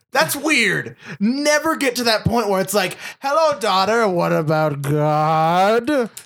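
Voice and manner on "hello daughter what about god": mock preachy mom. Mother's preachy greeting mocked.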